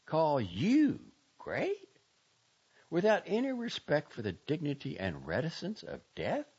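high, incredulous scorn; scornful. A narrator's reading. Girl scorning the doctor who exploits the dead, contemptuous. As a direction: throw the question at him with contempt.

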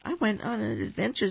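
high, quick little deer voice; shivering. A narrator's reading. Baby Deer explains to Koko, lightly voiced and shivering. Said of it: "said Baby Deer, shivering".

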